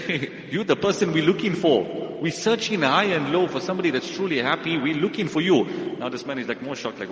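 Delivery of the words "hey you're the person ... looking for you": louder, higher, eager and thrilled; excited discovery. Retold or imitated. Ministers telling the shirtless man they found him, excited. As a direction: announce you've found him at last.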